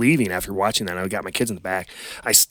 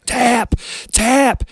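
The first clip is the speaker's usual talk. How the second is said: high, loud shout from the sidelines; yelling tap; 8 dB louder than usual. Retold or imitated. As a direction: yell at the mat for him to give up. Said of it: "and I'm yelling".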